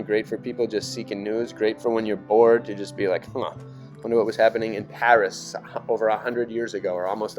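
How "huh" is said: ordinary talk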